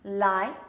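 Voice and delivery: "Light" loud, with an open mouth and the tongue coming down; clear L. Shown as a demonstration. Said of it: "so that's the L".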